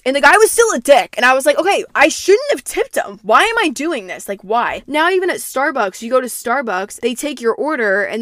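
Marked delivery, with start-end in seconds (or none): 1.6-4.8 higher, louder retold protest; worked-up venting, retold; exasperated outburst. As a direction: replay being fed up, pitched up and loud. Retelling her own exasperated outburst.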